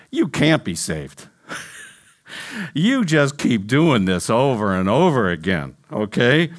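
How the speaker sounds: a hint of a low, condemning voice in the head; accusing inner voice. Voice in a believer's head after sinning, lightly acted accusing tone. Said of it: "you hear that voice in your head".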